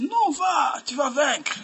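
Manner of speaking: high-pitched mock prophets' cheer; acted rousing urging. False prophets urge the king to battle, acted rousing cry. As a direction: cry it out as if sending someone into battle.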